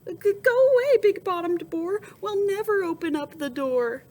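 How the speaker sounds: frightened defiant shout. Acted. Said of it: "they answered".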